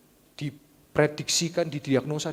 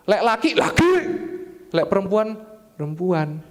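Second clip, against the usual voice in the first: emphatic; 4 semitones higher, 4 dB louder than usual. Real, not acted. Emphatic sermon point.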